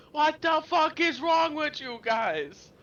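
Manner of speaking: high, slow, drawn-out mock scream. Heckler beside Arnold berating inventors, screaming. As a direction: scream in strained disbelief. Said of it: "gonna be screaming in the crowd".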